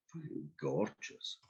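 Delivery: slow, breathy whispered aside; whispered boast. Real, not acted. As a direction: drop to a whisper to brag. Boastful aside about own looks.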